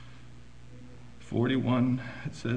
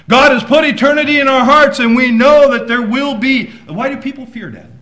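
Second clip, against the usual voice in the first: loud, driving preacher's voice; fiery preaching; 13 semitones higher, 17 dB louder than usual. Acted. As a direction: proclaim it to the church. Preaching at full force.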